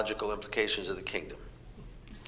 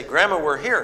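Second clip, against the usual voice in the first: calling out; 6 semitones higher, 8 dB louder than usual. Retold or imitated. Kids calling out arriving.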